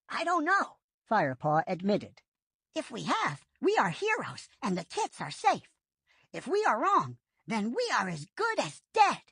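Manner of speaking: a quick-thinking apprentice making a plan; Firepaw's voice. A narrator's reading.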